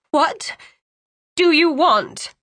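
high, frightened and trembling; quivering voice. A narrator's reading. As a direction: ask it shaking with fear. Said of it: "her voice quivering".

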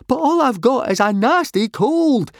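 put-on high, loud protesting boy. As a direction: protest it shrilly as a kid. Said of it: "he protested".

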